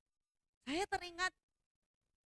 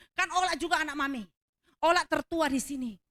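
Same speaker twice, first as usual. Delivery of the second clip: high, loud, quick mock protest; ultimatum pembantu; 3 semitones higher, 8 dB louder than usual. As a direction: imitate the maid insisting on her rank. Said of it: "dia juga ultimatum saya". (protes lantang bernada tinggi, menirukan pembantu)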